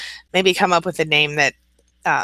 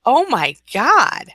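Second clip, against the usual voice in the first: shocked gasp; 8 semitones higher, 4 dB louder, much slower than usual. Real, not acted. Shocked by hammer story.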